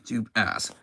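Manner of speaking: ordinary talk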